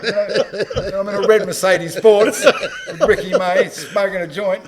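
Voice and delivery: high, loud and slow through laughter; laughing. Real, not acted. Storyteller laughing at the memory, loud laughing. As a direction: laugh it out in disbelief.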